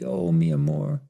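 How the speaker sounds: a touch of sobbing pleading. Acted. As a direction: beg him not to leave, crying. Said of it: "sobbing wildly".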